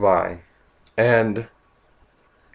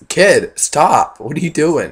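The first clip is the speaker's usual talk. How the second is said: louder, higher scolding call; 5 semitones higher, 6 dB louder, slower than usual. Retold or imitated. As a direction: stop the kid loudly. Scolding a kid in story.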